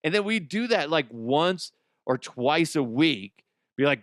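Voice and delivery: ordinary talk, nothing marked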